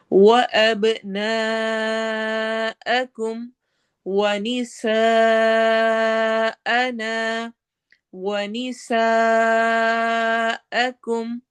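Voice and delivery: slow chanted tajweed with a long drawn ha; Quranic recitation. Shown as a demonstration. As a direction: recite it, stretching the vowel. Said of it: "سیٹی والی آواز ہوگی اور مد کو لمبا".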